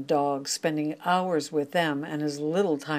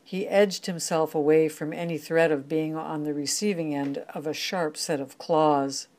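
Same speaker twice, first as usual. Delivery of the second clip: ordinary delivery, nothing marked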